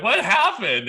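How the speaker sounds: high, loud startled cry; shocked question. Retold or imitated. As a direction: sound caught off guard. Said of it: "they're like".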